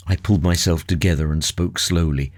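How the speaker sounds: usual speaking voice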